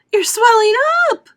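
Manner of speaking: very high, loud shriek; scream. A narrator's reading. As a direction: shriek it in terror. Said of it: "screamed Missus Beauregard again".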